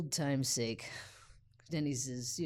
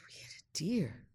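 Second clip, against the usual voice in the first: stunned whisper. Retold or imitated. Stunned by the news.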